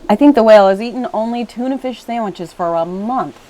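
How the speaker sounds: pinched-nose voice; holding nose. A narrator's reading. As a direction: say it with your nose held shut. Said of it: "holding his nose".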